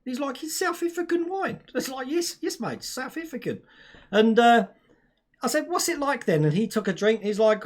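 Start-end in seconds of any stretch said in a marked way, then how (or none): 0.4-1.6 very high mock South African accent. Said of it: "then the accent came out". 2.1-3.6 high mock South African accent; hammed-up South African, pitched up. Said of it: "then the accent came out". 5.7-6.4 high-pitched mock South African; South African accent. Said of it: "we had to speak like".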